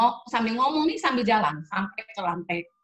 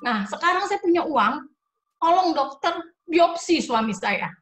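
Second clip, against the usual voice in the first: bersemangat memohon; 9 semitones higher, 6 dB louder than usual. Retold or imitated. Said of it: "Saya bilang".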